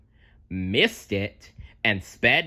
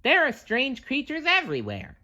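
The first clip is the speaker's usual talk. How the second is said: much higher, squeaky cartoon sponge; SpongeBob voice. A narrator's reading. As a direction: play the eager sponge, pitched way up. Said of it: "SpongeBob told himself".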